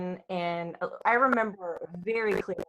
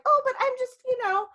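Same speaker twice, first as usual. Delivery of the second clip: much higher, louder, sugary fake-innocent voice; mock innocent stranger; 5 dB louder than usual. Mother mocking the stranger who offered candy, high innocent put-on. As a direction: play the meddling shopper acting all innocent. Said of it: "she's trying to be like".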